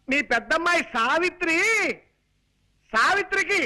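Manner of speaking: high, projected announcing; strong voice. Acted. Son-in-law announcing a match to mother-in-law, strong voice. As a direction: call the news out for deaf ears. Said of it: "స్ట్రాంగా?".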